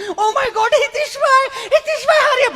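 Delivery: hammed-up scared lady; panicked squeal. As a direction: shriek for help in mock panic. Frightened lady runs to him.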